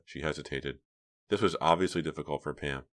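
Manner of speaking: ordinary delivery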